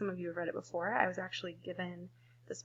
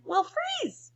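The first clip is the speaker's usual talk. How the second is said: high squeak; 4 dB louder than usual. Acted. Fish fear freezing.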